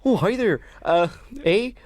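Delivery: high-pitched polite Canadian greeting; mock Canadian voice; Canadian accent. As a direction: try a Canadian accent with a questioning eh. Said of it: "That's, that's more Canadian".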